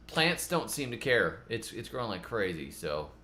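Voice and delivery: usual speaking voice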